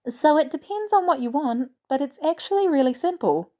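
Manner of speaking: put-on a shy teenager trying to help; girl's voice. A narrator's reading. Teen girl explains coffee machine.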